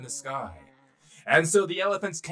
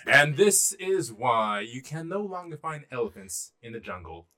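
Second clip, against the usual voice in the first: ordinary delivery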